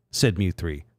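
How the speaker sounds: usual speaking voice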